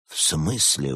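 plain reading voice

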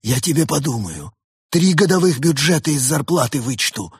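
irate chief cutting into the call; threatening boss. A narrator's reading. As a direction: threaten a dithering underling. Boss breaks in threatening technician.

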